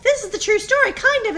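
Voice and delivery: high, lightly voiced explaining mom; chirpy mom voice to kids; quoting herself to kids. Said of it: "and I'm like".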